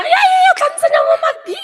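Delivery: very high, loud and fast, almost shrieked; eager squeal. Retold or imitated. Promising to spill on set, fast excited squeal. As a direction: gush it out in a rush.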